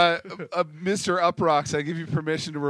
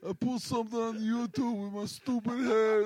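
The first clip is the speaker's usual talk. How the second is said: alter ego voice; 8 semitones higher than usual. Pretend. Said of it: "creating someone's alter ego is a consistent accent".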